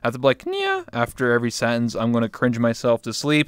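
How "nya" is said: put-on squeaky cat girl nya; a cute little cat mew; cutesy nya speak. Shown as a demonstration. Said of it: "I have to sound like a Neko cat girl".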